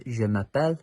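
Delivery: "Je m'appelle." said in clear French; French phrase. Shown as a demonstration. Scout teaching a name phrase in French, careful pronunciation. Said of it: "My name is in French is".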